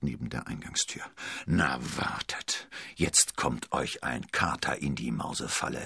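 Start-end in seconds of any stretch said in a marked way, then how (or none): 1.5-5.8 dark, lowered voice of warning; growling. A narrator's reading. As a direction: growl a threat to yourself. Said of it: "knurrte er".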